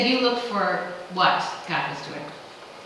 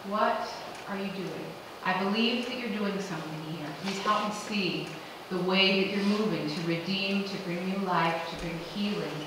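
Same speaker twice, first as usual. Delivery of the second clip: soft, hushed prayer; quiet prayer; 2 semitones lower, 6 dB quieter than usual. Retold or imitated. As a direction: pray it gently and humbly. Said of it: "let that be the check".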